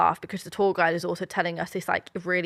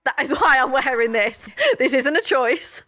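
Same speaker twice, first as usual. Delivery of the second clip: higher, laughing mock indignation; laughing protest; 5 semitones higher, 4 dB louder than usual. Retelling the embarrassing fashion moment, laughing mock protest. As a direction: protest it through your laughter.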